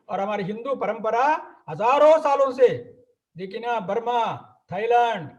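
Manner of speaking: ordinary talk, nothing marked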